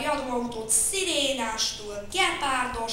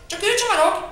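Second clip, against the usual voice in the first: much higher, loud shout; 11 semitones higher, 12 dB louder than usual. Acted. Child shouts to blame the other, loud. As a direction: yell it out to accuse him. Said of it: "kiabált Vacska Mati".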